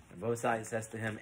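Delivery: ordinary talk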